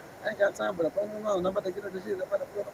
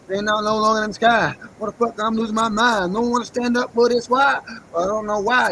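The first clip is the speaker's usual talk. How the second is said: loud rant; 8 dB louder than usual. Real, not acted. Venting in alarm about birds.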